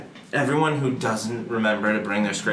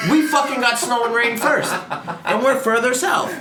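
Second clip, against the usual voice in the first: high, loud, laughing outrage; laughing rant; 12 semitones higher, 3 dB louder than usual. Real, not acted. Complaining to a friend about Massachusetts, high and laughing. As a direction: laugh through the indignation. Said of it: "laughing rant about snow".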